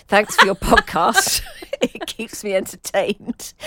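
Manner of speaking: laughing through the words. Real, not acted. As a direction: giggle while you read it. Laughing while reading letter.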